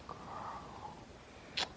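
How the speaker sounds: breathy aside to herself; whispered "girl". Retold or imitated. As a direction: hush it under your breath. Inner thought, whispered.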